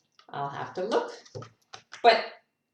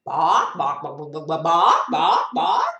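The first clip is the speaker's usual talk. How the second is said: loud squawking clucks, rising at the end; chicken cluck; 10 dB louder than usual. Pretend. Reader showing what chickens say, clucking loudly. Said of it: "chickens in English say".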